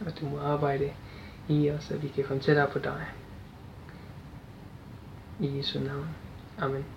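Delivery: soft, earnest spoken prayer. Real, not acted. Preacher praying to Jesus with the congregation, soft and earnest. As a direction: pray quietly on behalf of the congregation. Opening prayer before the sermon.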